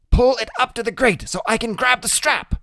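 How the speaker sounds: put-on high, brisk know-it-all boy; a small genius giving orders; brainy small boy. A narrator's reading. Boy hurrying his big friend along, bossy and high. Freak's voice in the narration.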